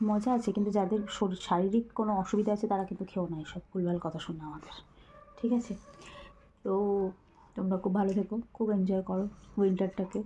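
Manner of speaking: hushed late-night talk; speaking softly. Real, not acted. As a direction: keep your voice down, people sleep. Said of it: "আমরা এত আস্তে আস্তে কথা বলছি". (রাতে চাপা গলায় আস্তে কথা বলা)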